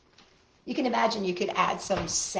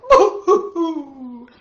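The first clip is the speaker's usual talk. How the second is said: slow, loud, laughing boo-hoo-hoo; mock crying; 5 semitones higher, 9 dB louder, much slower than usual. Reading to kids, the little boy's hammed-up sob. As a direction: wail it slowly like a pretend crybaby. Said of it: "the little boy cried".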